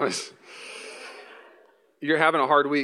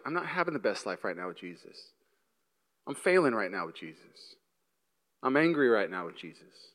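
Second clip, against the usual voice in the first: softer, lower confession; quiet lament; 8 semitones lower, 8 dB quieter than usual. Retold or imitated. Struggling believer answering a cheery post, quiet sad lament. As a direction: admit it heavily.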